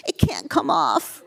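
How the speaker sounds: put-on slow, a touch of a teary child; a little boy near tears, slowed and wobbly; almost in tears. The boy protests to the doctor, almost in tears. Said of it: "Almost tears up".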